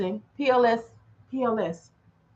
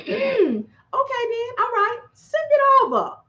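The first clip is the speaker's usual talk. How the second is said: dispatcher voice; 10 semitones higher than usual. Retold or imitated. Dispatcher voiced in retold call.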